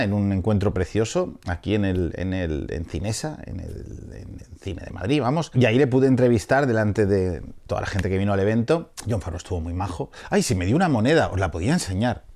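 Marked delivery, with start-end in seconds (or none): none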